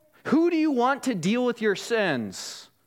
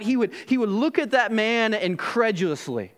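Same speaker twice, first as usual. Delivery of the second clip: normal talking voice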